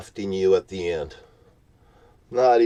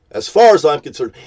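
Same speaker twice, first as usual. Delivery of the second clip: louder repeat; 4 semitones higher, 6 dB louder, faster than usual. Real, not acted.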